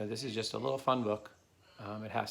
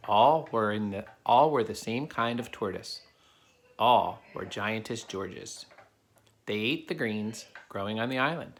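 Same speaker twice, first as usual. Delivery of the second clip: put-on steady storybook narration for kids; reading a story aloud; 5 dB louder than usual. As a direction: read it to the class like a picture book. Reads a picture book to children.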